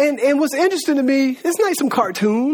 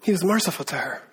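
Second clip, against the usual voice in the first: soft, lower repeat, let it sink in; hushed; 5 semitones lower, 7 dB quieter than usual. Real, not acted. Preacher dwells on Jesus's mercy to her, hushed. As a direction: drop to a gentle hush.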